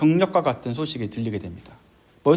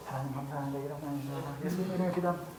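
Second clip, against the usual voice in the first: very hushed, hurried prayer under the breath; furtive prayer; 15 dB quieter, faster than usual. Shown as a demonstration. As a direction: pray secretly so nobody notices. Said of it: "그래서 그때부터 어떻게 기도했냐면". (몰래 숨죽여 기도하듯)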